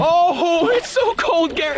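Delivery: high, loud gasping cry; shocked cold yelp. Real, not acted. Jumping into icy water.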